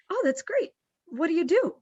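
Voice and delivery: high, eager mock delight; gush, then ask what they actually do; bright interest. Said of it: "I say".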